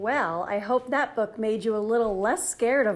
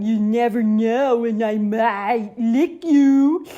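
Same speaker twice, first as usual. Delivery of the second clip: slow, much louder hammed-up threat; mock terrifying; 2 semitones higher, 10 dB louder, slower than usual. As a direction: lean in with a goofy monster menace. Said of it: "trying to sound terrifying".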